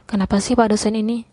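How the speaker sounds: ordinary delivery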